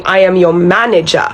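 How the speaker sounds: ordinary talk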